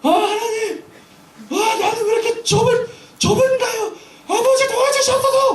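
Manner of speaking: very high, loud laughing plea; crying out to God. Retold or imitated. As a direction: holler a prayer alone in the car, laughing. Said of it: "막 하면서". (웃으며 아주 높고 크게 부르짖는 기도)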